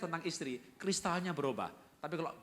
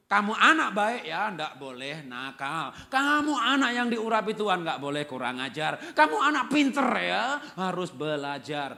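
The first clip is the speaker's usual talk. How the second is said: loud, higher, slow telling-off with kind words; angry but positive scolding; 5 semitones higher, 10 dB louder, slower than usual. Acted. Mother shouting positive words at a naughty child. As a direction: yell the praise like a reprimand. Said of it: "Jadi boleh marah".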